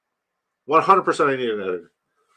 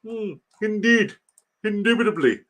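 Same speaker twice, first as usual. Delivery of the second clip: hammed-up English accent; 10 semitones higher than usual. Streamer answering himself as courtier, hammed-up English accent. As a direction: agree grandly in an overdone high English voice. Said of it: "I'm done with the English accent".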